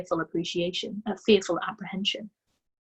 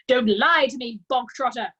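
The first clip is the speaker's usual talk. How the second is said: loud, higher barked warning; 5 semitones higher, 6 dB louder than usual. A narrator's reading. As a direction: bark the name at him. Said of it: "barked the Trunchbull".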